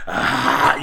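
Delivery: short loud mock growl at a kid; a scary "Ah" to startle a child; demon face growl. Adult scaring a misbehaving kid with a mock growl. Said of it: "you give them the demon face".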